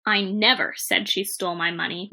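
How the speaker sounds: "never" punched, the other words unstressed; stress on NEVER. Shown as a demonstration. Teacher shows students a full denial, stress on "never". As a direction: hit "never" hard to deny it completely. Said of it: "as different words are stressed".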